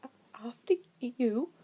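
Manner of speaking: a timid man stumbling through; stuttering nervous. A narrator's reading. Voiced stuttering professor.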